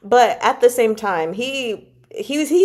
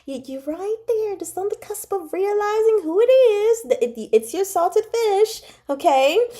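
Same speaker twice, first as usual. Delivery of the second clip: urging the character; 8 semitones higher than usual. Retold or imitated. Fan pleading with Qing Yu to see the truth, rising exasperation. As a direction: coax him, then push harder as he almost gets it. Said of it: "And I'm like".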